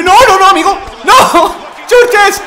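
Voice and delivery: high, loud laughing screams; laughing shout. Real, not acted. Being beaten up in game.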